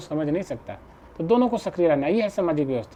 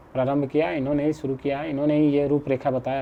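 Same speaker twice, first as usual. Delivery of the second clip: usual speaking voice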